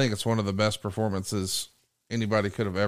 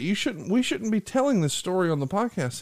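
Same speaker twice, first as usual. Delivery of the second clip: high-pitched scolding objection; high, fussy retold objection; retold indignant protest; 10 semitones higher than usual. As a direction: act out a worked-up protest, pitched way up. Retold argument over telling a story.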